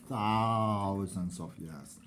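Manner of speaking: THOUSANDS stretched out long and low; "thousands" dragged slowly, dropping in pitch; drawn-out emphasis. Real, not acted. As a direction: draw out the word thousands, slow and low.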